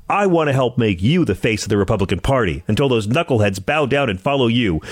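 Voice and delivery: normal talking voice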